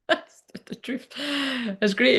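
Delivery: breathy laughing, high pitch. Real, not acted. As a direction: laugh it out, almost whispered. Laughing admission of honesty.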